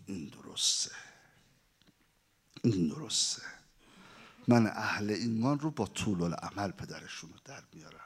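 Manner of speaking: low, hushed, menacing voice, half-whispered; a demon gloating quietly over his plan; sinister devil. Acted. Devil approves, then vows. (صدای بم و آهستهٔ شیطان)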